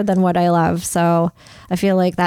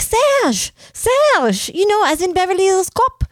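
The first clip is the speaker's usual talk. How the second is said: high, hammed-up French name introduction; playful French accent, pitched way up; 10 semitones higher than usual. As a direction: mimic a Frenchman explaining his name. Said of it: "the guy with the accent".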